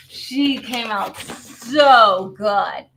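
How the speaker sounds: very slow, loud marvelling; excited gushing. Real, not acted. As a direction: stretch SO right out with delight. Gushing over the finished card.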